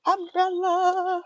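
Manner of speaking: high, drawn-out shout for an umbrella; calling out. Pretend. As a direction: holler it long and loud, held high.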